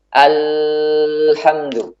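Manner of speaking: very slow "Alhamdu" with the lam drawn out far too long; overlong lam. Shown as a demonstration. Teacher showing the wrong, overlong lam, very drawn out. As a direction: hold the l of "Alhamdu" on and on. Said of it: "Ini juga tidak tepat".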